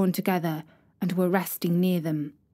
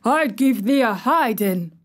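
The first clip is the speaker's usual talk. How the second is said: put-on angry old gardener; 3 semitones higher, 4 dB louder than usual. A narrator's reading. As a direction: scold her from the wall. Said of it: "he cried".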